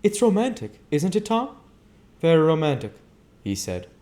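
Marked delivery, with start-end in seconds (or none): 0.0-1.6 high, louder gushing society lady; Daisy gushing to her dinner guests. Acted. Daisy's chatter. 2.2-2.9 higher, louder man's reply; Tom's voice. Acted. Tom answering Daisy.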